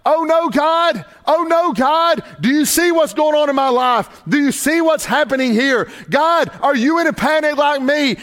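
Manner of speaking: high, pleading mock panic to God. Preacher voicing a suffering believer to God, high mock panic. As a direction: act out a frightened believer crying to God. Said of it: "I'm saying".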